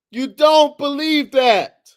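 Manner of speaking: loud, high, slow insistence; insistent. Real, not acted. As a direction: lean hard into the accusation. Repeated accusation.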